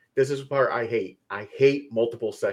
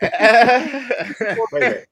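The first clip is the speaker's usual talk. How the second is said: surprised; 11 semitones higher, 6 dB louder, much slower than usual. Real, not acted. Sudden realization joke.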